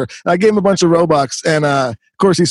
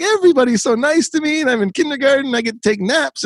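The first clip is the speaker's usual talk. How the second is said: much higher, sweet little-kindergartner voice; innocent little kid; 9 semitones higher than usual. Retold or imitated. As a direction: sound like a happy five-year-old. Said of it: "you're still just like".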